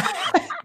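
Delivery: sudden high giggle; high squeaky laugh. Real, not acted. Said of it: "What the hell was that?".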